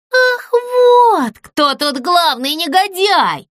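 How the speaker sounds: high, drawn-out outraged cry; indignant shout. A narrator's reading. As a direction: explode at the villain, stretching the start. Box confronts the villain, outraged. (возмущённый возглас)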